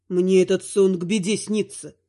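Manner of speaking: low, tender dark lord; deep male voice, frightened husband. Acted. (низкий голос тёмного властелина)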